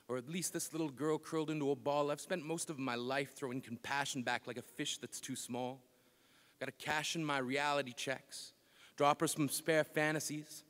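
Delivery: soft, low poetic aside; subdued spoken-word delivery; hushed confession. Acted. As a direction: bring the poem down to a quiet, low confession. Poem drops to intimate confession.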